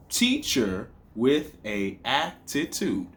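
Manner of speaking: Teacher with a attitude, slowly counted out; syllable by syllable. Shown as a demonstration. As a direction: separate beats through Teacher with a attitude. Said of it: "that's seven syllables".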